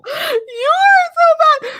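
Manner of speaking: real laughing shriek of mock outrage. Real, not acted. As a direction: squeal it through laughter, scandalised. Shocked laugh at friend's joke.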